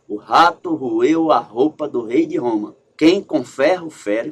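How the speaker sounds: rato, roeu, roupa, rei, Roma com R bem marcado, devagar; aliteração marcada. Shown as a demonstration. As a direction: acentue cada R e F, devagar. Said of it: "os R's e também a letra F". (destacando os erres e efes devagar)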